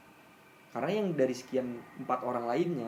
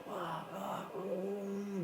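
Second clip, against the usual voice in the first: higher, softer strained moan, as if fighting in sleep; mock the groaning you made while being pulled; a hushed, higher howl of struggle; imitated moaning cry; 7 semitones higher, 6 dB quieter, much slower than usual. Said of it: "sambil ngelawan kayak teriak".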